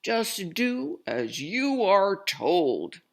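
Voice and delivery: loud furious bark; barking boss. Acted. Circus boss barking angrily at the captain. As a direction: snap orders at the captain. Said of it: "barked Mister Payne".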